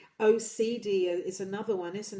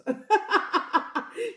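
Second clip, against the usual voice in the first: laughing; 12 semitones higher, 7 dB louder than usual. Real, not acted. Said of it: "laughing at own accent attempt".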